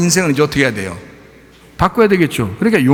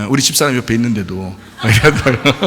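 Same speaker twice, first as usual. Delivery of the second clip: ordinary delivery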